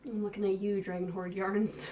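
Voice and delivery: ordinary delivery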